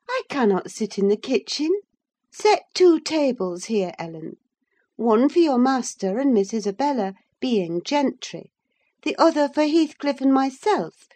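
high, teasing mock orders; mock his fussiness, high and animated; droll, half angry. A narrator's reading. Wife mocks husband's fastidiousness, droll high-pitched orders. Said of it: "half angry, half laughing at his fastidiousness".

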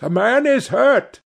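high, urgent alarm call. A narrator's reading. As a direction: raise the alarm, pitched high. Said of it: "at the top of his voice".

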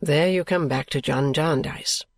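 put-on low young man's voice, mildly impatient; a touch of impatience, pitched low. A narrator's reading. Richard answering Esther's warning, mildly impatient. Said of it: "said Richard impatiently".

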